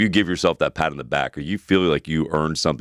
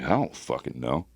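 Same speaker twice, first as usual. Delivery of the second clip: softer, slow, baffled mumble; dazed mumble; 4 semitones lower, 7 dB quieter than usual. Retold or imitated. Hungover guy answering his girlfriend, slow baffled mumble. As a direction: mumble it like you truly have no clue.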